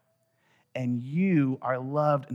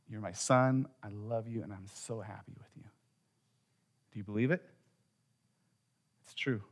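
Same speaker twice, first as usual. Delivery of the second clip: soft, low, reassuring dad; gentle assurance, fading very quiet; tender fatherly affirmation; 3 semitones lower, 7 dB quieter than usual. Retold or imitated. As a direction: lower and soften it for your child. Father tenderly affirming his son.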